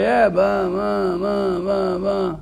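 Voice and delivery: dismissive blah-blah of the evil inclination; mocking Yetzer Hara. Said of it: "That's how the Yetzer Hara talks".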